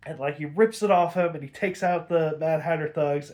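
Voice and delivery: ordinary talk, nothing marked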